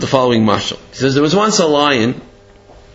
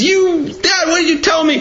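high-pitched, acted panicky accusation; scared protest; 12 semitones higher than usual. Scared cub back home to his dad, panicky protest. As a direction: confront Dad in a high, alarmed voice. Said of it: "He's all scared. He runs back home".

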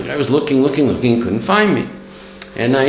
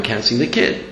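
ordinary delivery

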